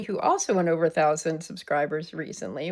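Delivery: ordinary talk, nothing marked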